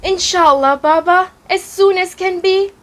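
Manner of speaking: put-on high-pitched child voice questioning a parent; eager child. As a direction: ask brightly, rising high on the final question. Child character answers and questions father.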